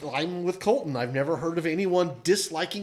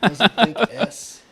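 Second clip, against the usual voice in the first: incredulous; 7 semitones higher, 9 dB louder, much slower than usual. Real, not acted. Mock outrage at hot take.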